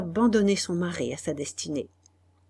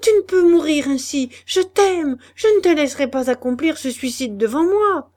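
high, loud, desperate refusal; Guillaume refusing; 9 semitones higher, 9 dB louder than usual. Acted. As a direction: forbid it with all your love. (refus passionné, voix haute et forte)

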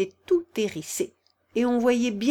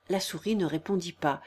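plain reading voice

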